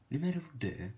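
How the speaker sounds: lightly put-on French accent on the number; mock French accent. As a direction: say it like a French speaker, playfully. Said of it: "I like the way French people talk".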